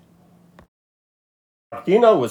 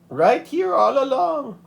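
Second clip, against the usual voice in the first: slow, much higher grandmother voice; wise grandmother; 14 semitones higher than usual. A narrator's reading. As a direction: say it knowingly, like a wise old abuela.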